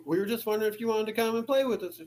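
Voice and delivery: put-on sing-song it like a child; little girl voice. Man imitating eerie girl's invitation, high childish voice. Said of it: "she goes".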